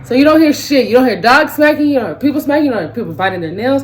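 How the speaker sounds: loud, punchy list; emphatic rant. Real, not acted. Ranting to fellow misophonians, loud and emphatic. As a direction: hammer each point home. Ranting about soundproof headphones.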